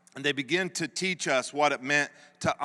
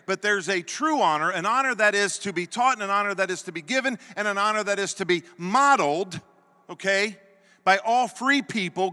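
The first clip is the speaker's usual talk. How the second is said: raised, emphatic sermon voice; preaching; 7 semitones higher, 6 dB louder than usual. Acted. Preacher drives home honoring parents to the congregation, emphatic and raised.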